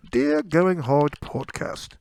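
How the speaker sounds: slow, put-on British accent. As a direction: read it as a drawn-out British caricature. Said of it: "I am your only British listener".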